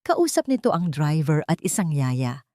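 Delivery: ordinary delivery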